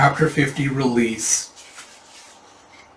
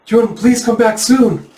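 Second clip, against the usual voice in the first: high, slow, louder pleading; anxious plea; 9 semitones higher, 6 dB louder, slower than usual. Acted. Villager wishing for Jun's return, high anxious plea.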